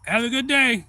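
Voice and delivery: loud, high send-off; acted farewell call. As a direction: call out a goodbye, imitating the diver. Old diver calls out cheerily.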